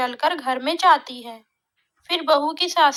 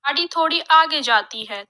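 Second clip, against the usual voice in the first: plain reading voice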